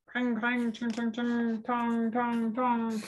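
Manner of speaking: high, ringing singsong clangs; metallic clanging. Acted. Knights flabbergasted by Boy, ringing clanks. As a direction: chime out armour noises evenly. Reader imitates metal noises.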